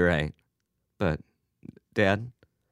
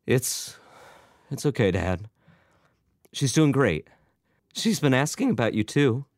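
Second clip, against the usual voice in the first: halting, higher, voice about to break; voice cracking; 6 semitones higher than usual. A narrator's reading. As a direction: reassure him while holding back tears. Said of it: "his voice cracked".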